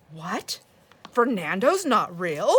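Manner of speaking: loud, drawn-out incredulous question; mock disbelief. Grandma feigns shock to grandson about her fake cat, mock disbelief. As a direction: feign shock, swooping loudly through each word.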